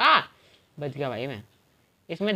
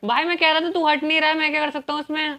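higher-pitched, indignant protest; protesting to a friend in a raised pitch; exasperated self-defence; 6 semitones higher, faster than usual. Real, not acted. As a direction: argue back, fed up. Defends himself in game argument.

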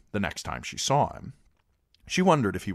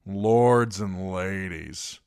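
slow, sneering announcement; disgusted proclaim; 3 semitones higher, 5 dB louder, much slower than usual. Acted. Gendry scoffing at the lord and lady, slow and disgusted. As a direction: drag it out in contempt. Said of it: "he proclaimed in a disgusted tone".

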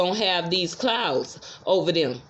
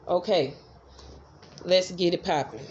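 ordinary talk

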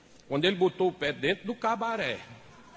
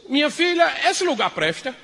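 louder, higher wary question; suspicious husband; 7 semitones higher, 6 dB louder than usual. Acted. Husband suspicious of the cabaret, loud wary question. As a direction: ask it nervously, on edge.

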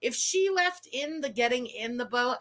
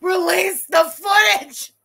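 loud, high, slow chant-like shout; demanding shout; 6 semitones higher, 10 dB louder, slower than usual. Acted. Fan shouting at the streamer to release it, loud. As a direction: yell it like a protest demand.

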